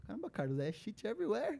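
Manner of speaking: a hint of mock dismay; mock exasperation. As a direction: complain to a coworker about the mess. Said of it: "Eu, cara".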